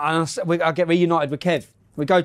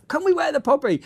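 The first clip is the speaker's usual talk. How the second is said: eager question; 10 semitones higher than usual. Retold or imitated. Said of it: "ringing home saying".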